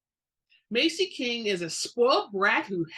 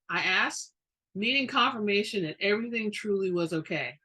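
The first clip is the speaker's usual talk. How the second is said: ordinary delivery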